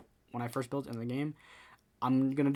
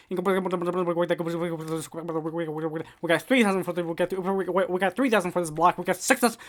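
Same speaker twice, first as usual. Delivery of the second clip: mock auctioneer calling bids, higher and louder; 5 semitones higher, 9 dB louder than usual. Streamer switches to auction mode for a block, mock auctioneer. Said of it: "I'm going auction mode".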